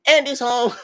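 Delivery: high squealed joke cry; mock scream. Serena screaming as a joke to friends. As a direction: shriek it like the toys' warning. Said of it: "Serena screamed".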